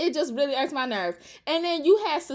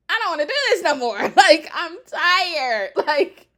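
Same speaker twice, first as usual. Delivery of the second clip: laughing exasperation; 3 semitones higher, 6 dB louder than usual. Real, not acted.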